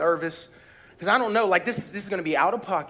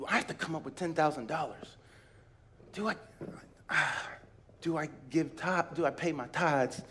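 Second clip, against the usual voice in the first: anxious inner doubt; 2 semitones lower, 9 dB quieter than usual. Retold or imitated.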